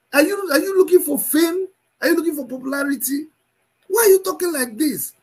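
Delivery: high-pitched, upset remonstrance; exasperated pleading. Real, not acted.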